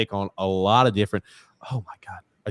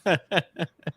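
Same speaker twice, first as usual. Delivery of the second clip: high chuckle; real burst of high, wordless laughter; laughing; 8 semitones higher than usual. Real, not acted. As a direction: let out a quick, high laugh. Laughs at co-host's Martin remark.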